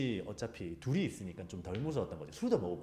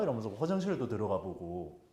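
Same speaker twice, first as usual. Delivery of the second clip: ordinary talk, nothing marked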